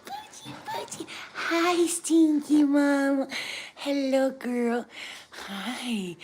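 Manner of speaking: squeaky, very high pet voice. Real, not acted. Cooing to her dog.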